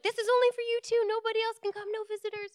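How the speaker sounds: high-pitched, agitated landlady impression; freaking-out landlady. Paranoid landlady forbidding guests to tenants, freaking out. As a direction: lay down the rules in a high voice. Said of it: "And she's freaking out".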